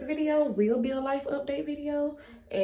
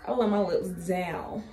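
usual speaking voice

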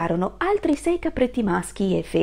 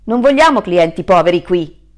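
loud, scornful doorman; disdainful porter sending away a poor guest; snooty hotel porter; 12 dB louder than usual. Acted. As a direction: look down your nose at him and bark it out. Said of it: "gli disse il portiere".

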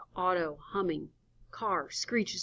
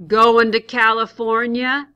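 calling out; 5 semitones higher, 17 dB louder than usual. Acted. Hitchhiker's voice calling.

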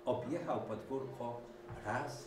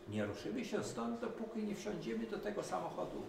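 much higher, fast obstinate declaration; stubborn declaration; 11 semitones higher than usual. A narrator's reading. Duck declaring they won't move, high stubborn. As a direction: refuse to budge. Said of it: "oświadczyła kaczka Kwaczka".

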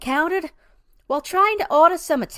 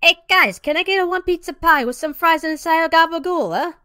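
relaxed, easy Italian-American pizza-shop accent; breezy Italian-American ordering voice; casual Italian-American accent; 4 semitones higher than usual. Shown as a demonstration. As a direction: order it like a laid-back Italian-American regular. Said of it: "you can say it casually, for example. Like this".

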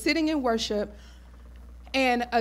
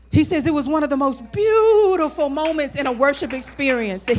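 louder, higher preacher's build; preaching at full force; 6 semitones higher, 7 dB louder than usual. Acted. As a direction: drive it home to the congregation.

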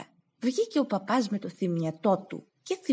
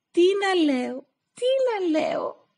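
much higher, pleading cry; desperate plea; 11 semitones higher, 4 dB louder than usual. Acted. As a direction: wail the question. Beaten Stavros asks desperately.

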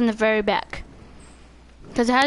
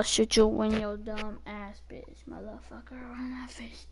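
hushed trash-talk whisper; 5 semitones lower, 12 dB quieter than usual. Real, not acted. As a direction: hiss the insults under your breath.

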